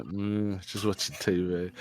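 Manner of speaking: low, very soft mumbled reply; uninterested dad. Retold or imitated. Dad half-listening to his son while watching TV, quiet indifference. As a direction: imitate a distracted dad, dropped right down.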